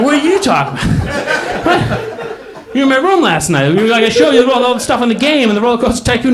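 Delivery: high, laughing retelling of his own protest; laughing disbelief. Retold or imitated. Retelling to the crowd his protest to a friend, laughing. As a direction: laugh through the words, amazed he forgot. Friend forgot last night.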